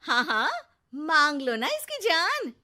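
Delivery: loud mocking yes yes; sarcastic mother. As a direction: jeer at the son. Neha's loud sarcastic jab. (ज़ोर से चिढ़ाते हुए)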